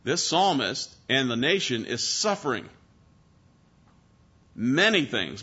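normal talking voice

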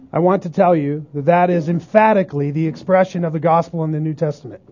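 ordinary talk, nothing marked

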